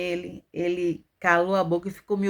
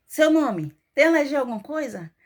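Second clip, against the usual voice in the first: louder, higher hint of hospital staff; lightly acted, insistent questioning; imitated staff questioning; 6 semitones higher, 4 dB louder than usual. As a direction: reel off intake questions as a mock nurse. Staff questioning her repeatedly during surgery.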